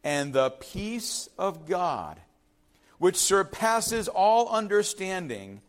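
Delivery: very slow, weighty; slow scripture reading. Shown as a demonstration. Preacher reading Philippians to the congregation, slow. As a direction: savour each word of the verse. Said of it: "reading scripture slowly".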